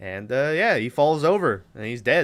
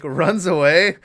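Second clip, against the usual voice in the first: ordinary talk, nothing marked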